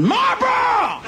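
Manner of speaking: high, slow screaming store clerk; hammed-up shrieking clerk; foreign clerk screaming. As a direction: scream it like a frantic cashier. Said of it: "at a seven eleven going".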